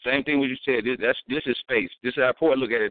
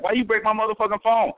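higher, mock angry woman; angry woman quoted; 7 semitones higher than usual. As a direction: yell like the girlfriend over her phone. Woman yelling about her phone.

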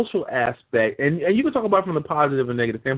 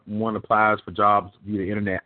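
usual speaking voice

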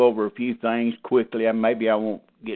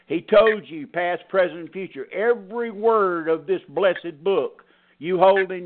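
higher, emphatic pulpit preaching; 5 semitones higher than usual. Acted. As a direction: drive the point home to the flock. Pastor preaching at congregation.

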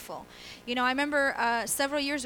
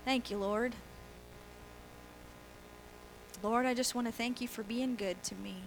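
soft, restrained polite thanks; reserved prayer; 7 dB quieter than usual. Retold or imitated. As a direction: pray quietly and politely, no passion. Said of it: "praising God American style".

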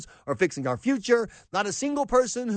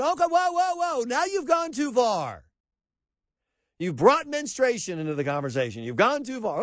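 higher, mock alarmed "whoa, whoa"; hammed-up faux outrage; mock outraged pundit; 5 semitones higher than usual. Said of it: "He said".

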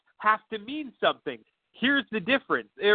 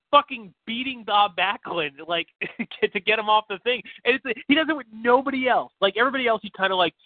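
ordinary talk, nothing marked

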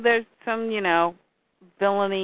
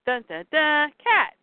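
dramatic sting; 6 semitones higher than usual. Acted. Dramatic reveal of Catwoman.

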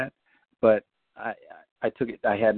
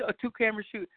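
ordinary talk, nothing marked

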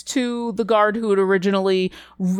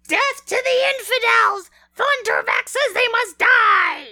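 very high, shrill shrieking kobold shout; kobold war cry. Acted. Kobolds yelling at the humans, high shrieking war cry. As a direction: scream it like a frenzied little lizard warrior. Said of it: "you hear the kobolds yelling".

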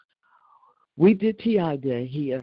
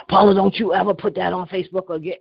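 louder, scolding order; harsh command; 3 semitones higher, 7 dB louder than usual. Retold or imitated. Mimicking Carol's rude order on the call, louder and scolding. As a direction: mimic the rude demand she got. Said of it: "But you don't say".

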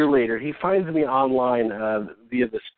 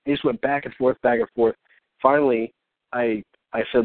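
ordinary talk, nothing marked

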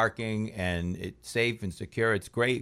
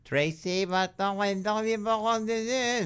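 put-on high, wavering old grandparent scolding; grandparent's voice; 12 semitones higher than usual. Grandparent scolding granddaughter Tracy, high old voice. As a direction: squeak it up like an elderly grandparent. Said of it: "would speak to her in French".

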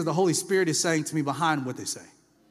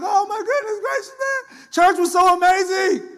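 high, loud, gushing put-on voice; gushing churchgoer; 12 semitones higher, 6 dB louder than usual. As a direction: gush like a thrilled churchgoer after service. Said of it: "I was like".

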